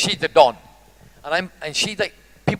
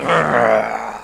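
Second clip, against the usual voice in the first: low rumbling dog growl; 10 semitones lower than usual. Retold or imitated. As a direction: growl deep in the throat like a dog. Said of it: "He goes".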